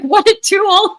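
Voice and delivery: high, loud, sneering; mocking scorn. Mocking a pompous lover.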